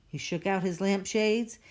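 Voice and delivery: ordinary delivery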